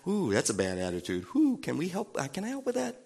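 soft, gently disapproving ooh; soft Holy Spirit voice. Retold or imitated. Holy Spirit gently chiding a believer's attitude, softly. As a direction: tut kindly at a bad attitude. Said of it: "This is the Holy Spirit".

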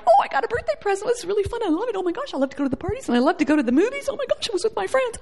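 high, rapid, giddy gush; mock gushing. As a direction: imitate an overexcited party-goer. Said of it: "spiritual joy is not like".

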